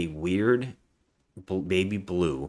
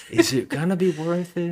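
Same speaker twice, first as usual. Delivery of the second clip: mock worried question; 10 semitones higher, 4 dB louder than usual. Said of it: "and I'm like".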